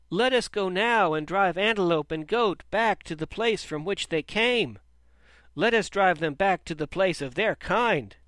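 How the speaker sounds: loud, high battle cry; shouting crowd. A narrator's reading. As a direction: stir them up to drive the goats out. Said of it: "they shouted".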